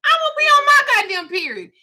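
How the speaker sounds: high, laughing mock warning; giggling don't-touch-me rant. Real, not acted. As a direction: warn them off through laughter. Ranting about period touching.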